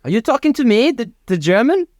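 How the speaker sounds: high-pitched questioning character voice; puzzled German man. Acted. German man unsure Michael means him, high puzzled question. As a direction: ask it high, comically puzzled.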